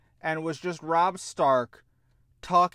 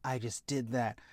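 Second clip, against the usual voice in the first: smug smirk; 5 semitones lower, 7 dB quieter than usual. Retold or imitated. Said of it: "standing there with a smirk like".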